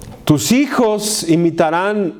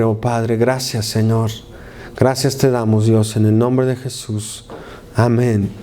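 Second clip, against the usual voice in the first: lower-pitched congregational prayer; low, steady address to God; 5 semitones lower than usual. Real, not acted. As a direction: lead the congregation in earnest prayer. Vamos a orar.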